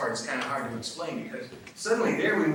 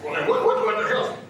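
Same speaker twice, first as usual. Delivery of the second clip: higher, louder mock outburst; pissed off; 9 semitones higher, 6 dB louder than usual. Father thwarted by his son, acting pissed off. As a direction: sputter it out, acting annoyed. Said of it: "He got kinda pissed off".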